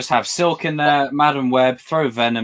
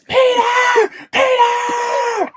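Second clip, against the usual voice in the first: piercing high cries, louder; mock damsel shriek; 4 dB louder, much slower than usual. Damsel in distress crying out to Peter, shrieking. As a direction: wail for help like a helpless damsel. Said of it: "It's like".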